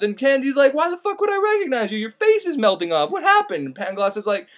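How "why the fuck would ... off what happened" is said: incredulous. Retold or imitated. Candide baffled by melted face.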